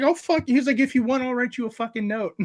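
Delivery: high, exasperated quote of the cop; mock exasperated cop. Police officer quoted in story.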